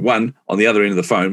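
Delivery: ordinary talk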